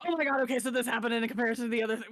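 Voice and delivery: fast, breathless nerd gush; gushing to a friend about lore, fast; excited rush. Retold or imitated. Excited lore talk rushed to Vex. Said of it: "I have all my conversations with Vex".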